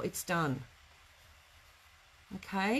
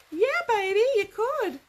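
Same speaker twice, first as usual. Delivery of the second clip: excited; 13 semitones higher, 7 dB louder than usual. Real, not acted. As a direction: crow it out with glee. Triumphant reply while turning basket.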